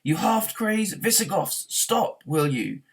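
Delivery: high, loud, slow furious yell; yelling at the demolition men; brawling yell. A narrator's reading. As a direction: bellow it at the wreckers. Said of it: "brawled Arthur".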